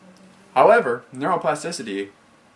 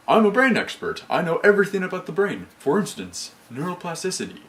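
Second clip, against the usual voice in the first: higher-pitched, self-important expert voice; technical voice; 7 semitones higher than usual. Retold or imitated. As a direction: lightly play a know-it-all brain expert. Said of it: "that's my technical voice".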